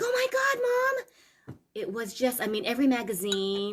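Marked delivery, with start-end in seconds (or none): 0.0-1.6 high, slow, drawn-out wail of Mom; a teen horrified, records in the yard; mock wail; dismayed teen. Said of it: "And I was like".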